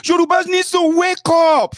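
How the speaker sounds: high, shouted wake-up call to the Yorubas; imitating a rallying call. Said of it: "she'll be like".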